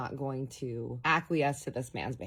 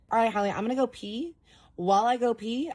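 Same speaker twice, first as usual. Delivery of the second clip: higher, louder firm warning; stern scolding; 8 semitones higher, 5 dB louder than usual. Retold or imitated. Scolding boyfriend before walking to the bathroom, high firm warning. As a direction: lay down the law, fed up.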